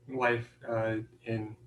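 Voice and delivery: usual speaking voice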